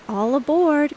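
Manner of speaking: high, loud call of all aboard; calling all passengers aboard, loud and high; cried out. A narrator's reading. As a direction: shout it like a boy playing ship's captain. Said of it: "cried Bunny Brown".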